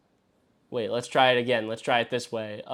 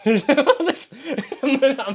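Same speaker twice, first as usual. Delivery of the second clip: disbelieving laugh; 9 semitones higher, 4 dB louder, slower than usual. Real, not acted.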